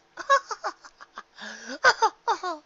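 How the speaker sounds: high, loud ladylike laugh; airy laugh. A narrator's reading. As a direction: laugh at your own joke. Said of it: "She laughed as if".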